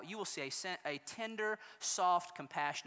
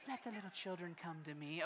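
soft Sunday-school Jesus; 10 dB quieter than usual. Shown as a demonstration. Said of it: "Nice, precious moments, Jesus".

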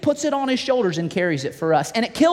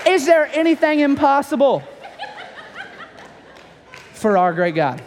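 rallying question; 6 semitones higher than usual. Real, not acted. Preacher's rhetorical question.

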